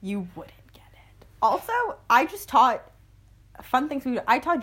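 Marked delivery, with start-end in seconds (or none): 0.0-1.2 breathy teasing whisper, much softer. Acted. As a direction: hush it like a shared secret. Teasing echo of friend's line.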